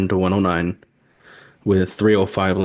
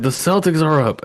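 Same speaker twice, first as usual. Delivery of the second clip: mild mock yell, raised in pitch but not in volume; retold yell; 7 semitones higher than usual. Husband retelling his call to his wife, mild mock yell. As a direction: repeat the call high, as a toned-down yell. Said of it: "I yelled to my wife".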